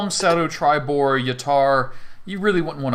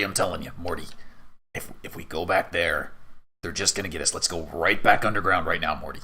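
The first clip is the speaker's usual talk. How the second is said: lower, insistent put-on weapon voice; low-voiced paranoid sidekick; paranoid Whelm; 5 semitones lower, faster than usual. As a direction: plead urgently to retreat, pitched down.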